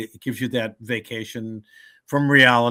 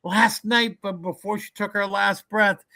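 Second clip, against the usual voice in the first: high excited gushing over the food; mock Italian mourner, much higher; jolly Italian mourners; 10 semitones higher than usual. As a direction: gush like a happy Italian relative. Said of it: "Italian wake, they'll be like".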